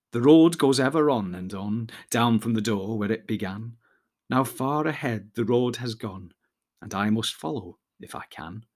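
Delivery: slow, fading poem recital. A narrator's reading. Frodo speaks the road poem aloud to himself, slow. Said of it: "saying slowly".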